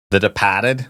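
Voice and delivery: dropped-r Boston take on Departed, rising; Boston accent. Retold or imitated. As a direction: say The Departed as Tha Depahted, Boston style. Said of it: "my very poor Boston accent".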